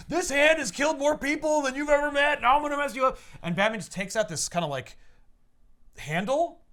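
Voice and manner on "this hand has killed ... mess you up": high, loud mock thug threat; hammed-up brute yelling a threat; party animal thug. Party animal goon threatens Batman in a high loud voice. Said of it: "and he's like".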